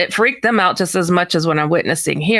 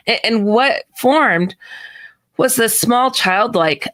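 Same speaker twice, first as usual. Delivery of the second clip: ordinary delivery, nothing marked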